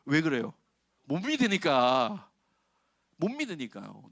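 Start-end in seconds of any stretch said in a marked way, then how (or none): 1.0-2.3 slow and loud, pressing 못 믿으니까; slow punched line; emphatic. Real, not acted. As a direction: hammer the point home. Punchline stressed.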